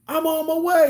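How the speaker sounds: high, loud eager yell; fired-up shout. Retold or imitated. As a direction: charge in to help. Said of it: "I'm like".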